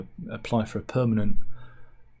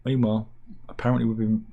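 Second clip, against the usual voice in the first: ordinary talk, nothing marked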